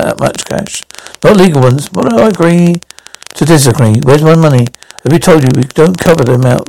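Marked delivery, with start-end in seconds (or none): 0.0-0.8 muttered aside. Acted. Trailing scoff at business claim.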